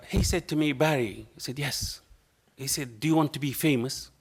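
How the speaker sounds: usual speaking voice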